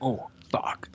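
whispered mock shock; hushed swear. Real, not acted.

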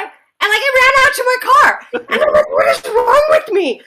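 high, loud, frantic retelling; hammed-up wail of self-reproach; mortified outburst. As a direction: blurt it out in mock panic. Retells fleeing to the car, mortified.

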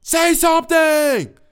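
high, slow drawn-out shout copying the film character; hammed-up horror movie yell; mock movie shout. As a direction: belt it like the opening line of the movie. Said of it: "the motherfucking character says".

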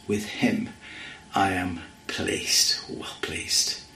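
hushed whisper of God's voice. Acted. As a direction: let the words fall to a reverent whisper. Voice of God from heaven.

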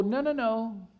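normal talking voice

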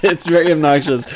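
ordinary talk

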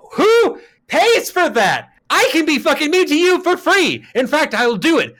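loud, high-pitched incredulous rant; indignant outburst. Real, not acted. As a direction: cry out in outraged disbelief. Indignant rant about rude servers.

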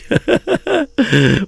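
high-pitched giggle; laugh. Real, not acted. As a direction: burst of laughter at one's own slip. Laughs at own slip.

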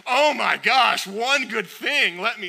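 very loud, high, over-the-top awe; mock amazement. God reacting to one good deed, mock amazed. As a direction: burst out in hammed-up astonishment.

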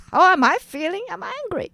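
high, loud indignant retort; retold angry answer, starting loud. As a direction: throw the question back indignantly.

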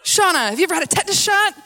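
loud, high-pitched medical question; urgent staff imitation. Hospital staff questioning patient urgently.

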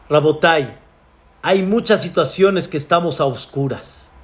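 ordinary talk

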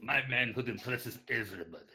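hammed-up Russian accent. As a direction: boast in a thick Russian accent. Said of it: "in the most heavy Russian accent you can muster".

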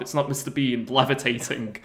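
ordinary delivery

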